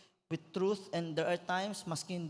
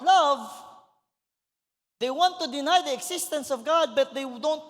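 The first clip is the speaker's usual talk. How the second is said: raised, loud preaching voice climbing higher; full-force preaching; 8 semitones higher, 7 dB louder than usual. Acted. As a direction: preach it out to the whole congregation. (pastor preaching to the church, loud and high)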